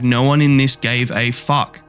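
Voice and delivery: normal talking voice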